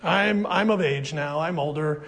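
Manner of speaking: low, soft, worn-out mock complaint; weary old excuse. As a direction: mimic a tired churchgoer who feels too old. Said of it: "with this attitude".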